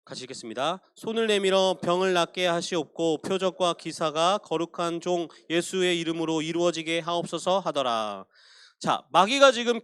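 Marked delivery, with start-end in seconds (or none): 0.0-8.2 measured, solemn reading of the prayer; scripture reading. Acted. Pastor reading Acts prayer to the congregation, solemn reading.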